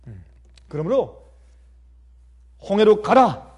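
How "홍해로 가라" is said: 크게 외치는 하나님의 명령; 힘주어 단호하게 명하듯. Acted. Acted command of God to Moses. (우렁찬 명령조 연기)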